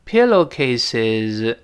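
"pillowcases" stretched, final z clearly voiced; very slow. Shown as a demonstration. Teacher makes the voiced ending audible, very slow "pillowcases". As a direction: draw out every syllable of "pillowcases". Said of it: "但读得很慢的时候".

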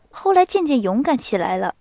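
usual speaking voice

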